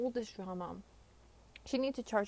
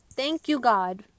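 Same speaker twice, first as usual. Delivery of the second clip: loud relief; 2 semitones higher, 12 dB louder, slower than usual. Retold or imitated. Relief at finally peeing.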